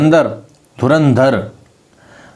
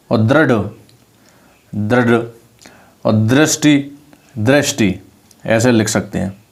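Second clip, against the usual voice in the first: normal talking voice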